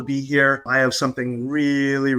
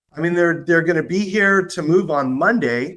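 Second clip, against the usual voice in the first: ordinary delivery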